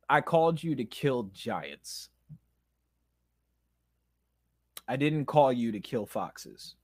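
measured words with a long pause; a lightly voiced, understated divine rebuke; God's voice. God correcting an angry believer, lightly voiced and measured. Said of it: "God tells me".

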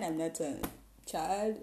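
ordinary talk

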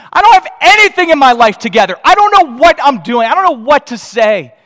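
high, loud mock fretting; hammed-up flustered protest; acted panicked self-doubt. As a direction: wail it like an overwhelmed beginner. Voices believers' self-doubt.

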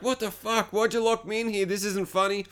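high, softened mock yelling; retold scream. Dad shouting through closet door, mock panicked scream. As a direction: imitate a trapped man's protest, held back. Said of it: "he's screaming, he's pounding".

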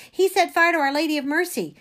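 high, loud and alarmed; urgent, agitated telling; blurted accusation. A narrator's reading. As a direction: blurt out the terrible news. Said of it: "I blurted".

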